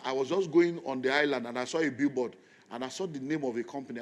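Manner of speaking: ordinary talk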